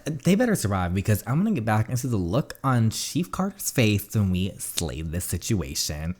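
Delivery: usual speaking voice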